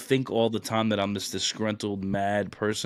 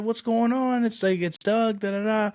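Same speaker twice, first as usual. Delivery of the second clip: much higher, retold hello; excited greeting; 12 semitones higher, 3 dB louder than usual. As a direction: greet an old acquaintance in a high, excited voice. Retold surprise greeting of old acquaintance.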